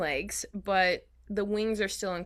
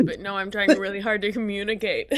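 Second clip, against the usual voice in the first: laughing; 10 dB louder, faster than usual. Real, not acted. Laughing while explaining.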